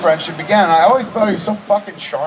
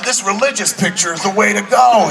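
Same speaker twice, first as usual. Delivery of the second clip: higher-pitched retold enthusiasm; excited friend; 7 semitones higher than usual. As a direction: imitate a friend gushing with excitement. Retelling friend's excitement over script.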